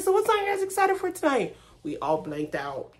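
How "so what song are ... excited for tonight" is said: high, quick interviewer voice; imitating Rory. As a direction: play the eager interviewer. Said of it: "He's like".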